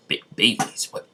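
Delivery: mild stammered shock. Acted. Madara hearing about elf babies, mildly shocked. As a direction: stumble on the first word, softly incredulous. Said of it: "Madara was in shock when he heard this".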